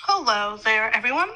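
talking around a lollipop in mouth; words rounded by candy in the mouth; sucking a lollipop. Real, not acted. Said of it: "And loud chewing, sorry. Lollipop".

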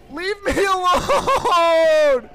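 slow, drawn-out shout from far off in the throat; mock creepy shout. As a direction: stretch every word like a haunted doll. Repeating the mannequin's line.

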